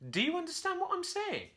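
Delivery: perplexed monkey. A narrator's reading. Puzzled Curly questions the copying parrot, high and baffled. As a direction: ask it bewildered and shrill. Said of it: "Curly was perplexed".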